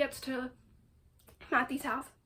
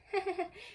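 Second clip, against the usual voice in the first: short higher-pitched laugh; 6 semitones higher than usual. Real, not acted. Reader reacting to a character's misreading, laughing. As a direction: laugh at the misunderstanding.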